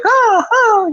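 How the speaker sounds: high, panicky mock whimper of scared flyers; scared passengers. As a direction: imitate frightened passengers moaning in turbulence. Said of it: "everybody's like".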